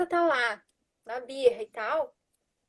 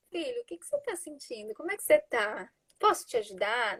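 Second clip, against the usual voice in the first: much softer, gentle and tranquil; calm tender; 4 dB quieter than usual. Shown as a demonstration. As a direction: soothe the child, ask softly. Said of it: "com um tom de voz tranquilo".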